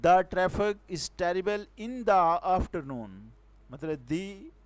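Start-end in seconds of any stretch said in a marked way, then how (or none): 0.0-3.1 very slow, every word spaced; slow example read. Shown as a demonstration. Teacher reads the next example to students, very slow. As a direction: lay out the sentence word by word. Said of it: "next example हम देखते हैं".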